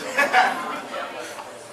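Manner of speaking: short airy laugh; a quick unvoiced chuckle at the story; breathy laugh. Real, not acted. Laughing at his client's seventeen days in Hawaii, breathy chuckle.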